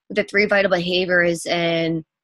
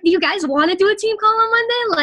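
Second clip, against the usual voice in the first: much higher, bursting with excitement; excited; 13 semitones higher, 3 dB louder than usual. Retold or imitated. Coach asking her team about the Monday call, in a thrilled high voice. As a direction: squeal the offer at the team like the best news ever. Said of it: "I get so excited".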